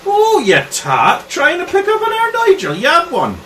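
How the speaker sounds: high-pitched scolding; jealous accusation. Acted. Local girl accusing stranger over Nigel, high indignant scolding. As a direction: lay into a rival, pitch soaring.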